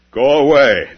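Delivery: sharp, loud "go away"; loud rebuff. Acted. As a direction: send him off with a loud command. Beloved rebuffs Rumi.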